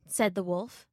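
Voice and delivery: usual speaking voice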